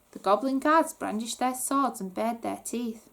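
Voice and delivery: plain reading voice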